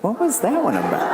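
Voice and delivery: high laughing question; high incredulous laugh-question; amused disbelief. Retold or imitated. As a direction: ask it half laughing. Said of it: "I was like".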